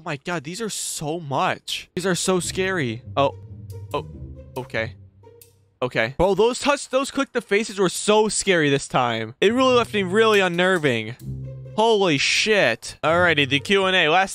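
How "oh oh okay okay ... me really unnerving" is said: normal talking voice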